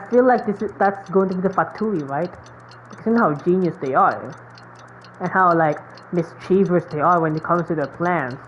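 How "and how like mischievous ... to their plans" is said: normal talking voice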